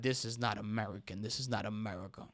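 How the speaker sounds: mocking the president. Podcaster mocks the president's complaint, soft and sneering. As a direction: lightly mimic a sour dismissal. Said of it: "And you're coming out like".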